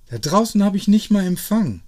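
hoch und laut rausgehauen; empört über den fehlenden Empfang klagen; empörter Ausruf. Acted. Abigail beschwert sich empört. (hoch und laut empört)

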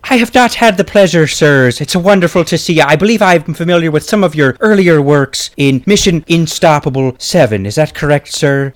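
courteous Irish brogue; Irish accent. Acted. Guest meeting an actor whose works he knows, Irish accent. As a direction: put on a polite Irish lilt. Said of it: "It is an Irish accent".

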